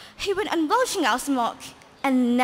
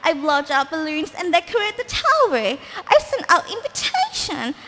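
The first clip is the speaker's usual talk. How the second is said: higher, louder giddy witch; excited witch voice; 5 semitones higher, 5 dB louder than usual. Acted. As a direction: gush as the cackling witch. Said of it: "she cried".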